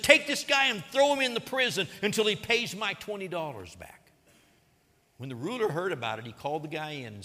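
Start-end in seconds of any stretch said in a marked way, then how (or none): 0.0-4.0 angry order. Retold or imitated. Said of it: "He got angry ... and said".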